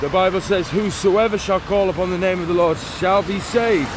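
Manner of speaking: ordinary delivery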